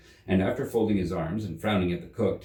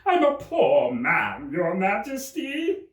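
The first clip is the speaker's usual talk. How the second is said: very high, slow, louder whimper; pleading Hatter; 11 semitones higher, 4 dB louder, slower than usual. Acted. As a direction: beg for mercy.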